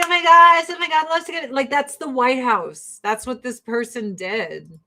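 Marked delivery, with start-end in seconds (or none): 0.0-1.5 high, loud hammed-up cheer of delight; mock excited squeal. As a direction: gush like a thrilled fan, overdone. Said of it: "They're like".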